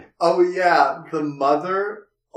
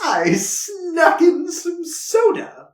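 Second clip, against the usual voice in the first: much higher, slow, sly put-on voice; sly whisper-ish quote; 14 semitones higher, 4 dB louder, much slower than usual. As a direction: draw it out high and sneaky, like a scheming friend. Acted quote of sneaky Herbert.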